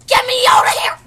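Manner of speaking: desperate cat shout. Pretend. Trapped cat yelling.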